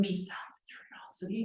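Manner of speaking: hushed, whispered aside; tentative whisper. Retold or imitated. Asking the lender about a missed lock deadline, tentative whisper. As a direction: slip it in under your breath.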